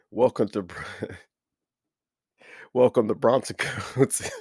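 intro broken by giggles; can't get through the welcome without laughing; cracking up. Real, not acted. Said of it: "the reason why I'm laughing".

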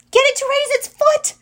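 very high, loud shout; high-pitched battle yell; yelling back. A narrator's reading. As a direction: yell urgent orders back at someone. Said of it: "she yelled back".

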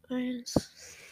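whispered count; whispering. Real, not acted. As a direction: breathe the number without voice. Counting down before a bite.

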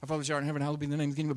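gabbling the Lord's Prayer fast; rushed prayer. Retold or imitated. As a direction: rattle it off before the ball game. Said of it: "how we sometimes say the Lord's Prayer".

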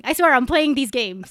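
ordinary delivery

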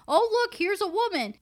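high mock-surprised pointing-out; lightly hammed-up condescension; condescending. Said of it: "don't fucking be condescending".

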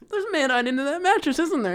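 high squeaky paranoid voice; high-pitched mock accusation. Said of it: "And then he's like".

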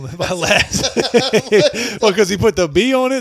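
laughing. Real, not acted. Laughing while protesting his name.

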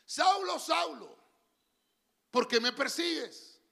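a hint of the Lord calling down from heaven; voice of God calling. The Lord calling Saul on the road, lifted and loud.

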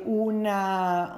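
ordinary delivery, nothing marked

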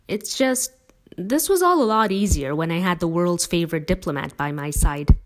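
ordinary delivery, nothing marked

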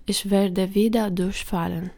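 ordinary delivery

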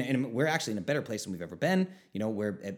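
normal talking voice

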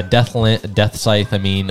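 normal talking voice

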